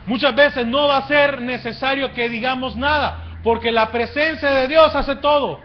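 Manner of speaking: high, loud preacher's rhetorical question; impassioned preaching. Real, not acted. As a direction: preach it at full force, phrase by phrase. Preaches at full force.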